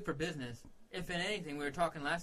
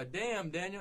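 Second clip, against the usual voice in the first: drawn-out, higher catchphrase; Damn Daniel video impression; 6 semitones higher, much slower than usual. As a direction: imitate a viral clip, stretching the words. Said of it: "seen the Damn Daniel videos".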